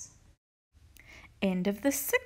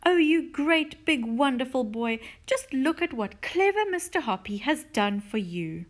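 loud, gushing praise; delighted cry; 5 semitones higher, 5 dB louder than usual. A narrator's reading. Missus Silver praising her tortoise, delighted and gushing. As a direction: gush over a beloved pet. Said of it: "she cried".

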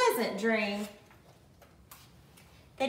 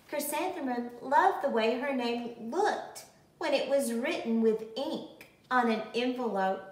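usual speaking voice

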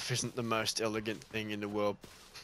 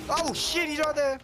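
panicked shriek; 8 dB louder than usual. Real, not acted. Enemy suddenly appears.

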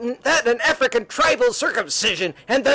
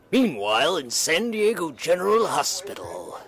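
lower, softer storyteller read; story narration; 8 semitones lower, 5 dB quieter than usual. A narrator's reading. Narrator leading listeners into the secret chamber, low storytelling voice. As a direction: let the narration sink low and hushed.

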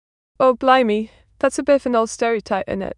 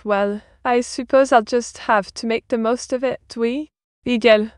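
put-on playful French accent with French words dropped in; mixed French accent. Streamer greeting and thanking viewers in a mock French accent. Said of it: "She gives the accent, it is a bit of a mix".

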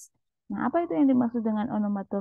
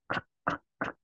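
whispered crunching "krek, krek"; voiceless creak like stepping on snow; imitating snow creaking; 7 dB quieter than usual. Teacher imitating snow underfoot for students, whispered. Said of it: "akan ada suara krek".

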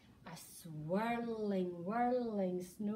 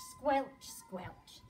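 plain reading voice

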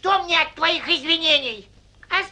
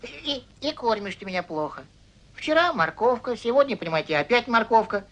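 low, soft grumbling complaint; 9 semitones lower, 4 dB quieter, faster than usual. Acted. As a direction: mutter a sulky grievance.

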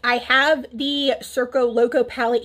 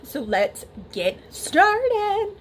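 sing-song catchphrase; 8 semitones higher, much slower than usual. Acted. Catchphrase to start the tutorial.

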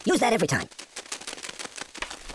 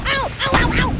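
string of high "ow" yelps; yelps of pain; 4 dB louder than usual. Pretend. Game character hurt over and over, high pained yelps. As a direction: yelp repeatedly as if being jabbed.

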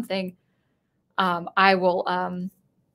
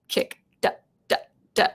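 unvoiced rhythmic duh duh mouth noise; beatbox the kick ducking the synth; sidechain pumping sound. Shown as a demonstration. Explaining sidechain to viewers, voiced pumping beat. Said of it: "like that".

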